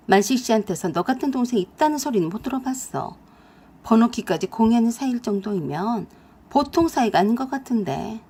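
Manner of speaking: usual speaking voice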